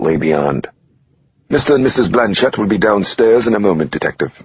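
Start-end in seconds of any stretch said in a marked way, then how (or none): 1.5-4.3 put-on light English accent, higher and polite; a sleek butler's English accent, lightly done. Butler greeting the detective in the penthouse, light English accent. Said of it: "the sleek butler said with an English accent".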